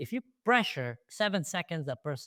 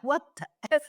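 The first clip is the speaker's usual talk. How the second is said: shocked disbelief; 12 semitones higher, 3 dB louder, faster than usual. Retold or imitated. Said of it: "I was like".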